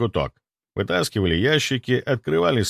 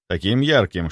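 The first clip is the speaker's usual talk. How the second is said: ordinary delivery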